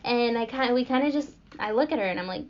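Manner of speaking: ordinary talk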